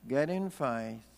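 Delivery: normal talking voice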